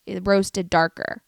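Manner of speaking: ordinary talk, nothing marked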